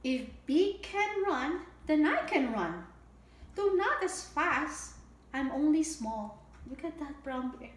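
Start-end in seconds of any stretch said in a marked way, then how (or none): 0.0-6.4 bright, sing-song storybook read; lilting picture-book reading; reading to children. A narrator's reading. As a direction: read it out like storytime for little ones.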